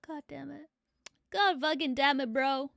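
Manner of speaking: ordinary talk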